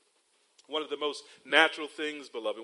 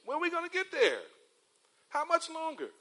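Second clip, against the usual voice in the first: put-on high whining child asking from the back seat; whiny kid; 12 semitones higher than usual. Preacher imitates kids whining from the back seat, high and whiny. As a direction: whine it like a bored toddler.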